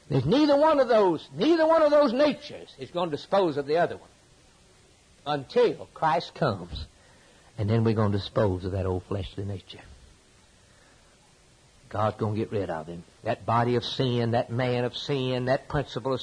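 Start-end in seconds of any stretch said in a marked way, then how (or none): none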